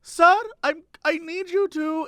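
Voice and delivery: put-on high-pitched lady with a slight German accent. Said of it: "sort of has a German accent".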